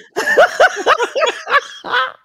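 high-pitched laugh; squealing laugh; high laughter. Real, not acted. Laughing at the joke.

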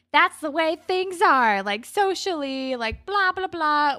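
high, loud mock proclamation; mocking speechifying. Characters arguing about social divisions, mocking speechifying.

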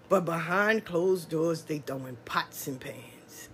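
slow, low, sinking into a whisper; hushed confiding. Real, not acted. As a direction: let it drop away like a secret, slowly. Confides what happens behind closed doors.

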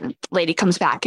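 ordinary talk

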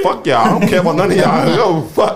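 jokey loud dismissal to friends; mock outburst. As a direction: yell it half-joking through laughs. Laughing mock outburst, group banter.

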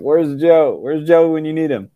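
mock call for Joe. Acted quote, calling for Joe.

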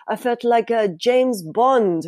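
loosely held mock French accent; terrible French accent. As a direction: imitate a French guy, badly. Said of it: "that's my terrible French accent".

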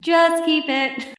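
high, slow, laughing coax; mock hoarder plea, giggling. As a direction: tease like a hoarder egging you on.

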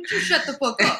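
laughing. Real, not acted. Teasing friend laughing.

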